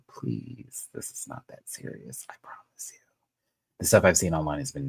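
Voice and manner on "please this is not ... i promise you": soft pleading whisper. Real, not acted. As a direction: whisper it, begging gently. Pleading with viewers not to attack.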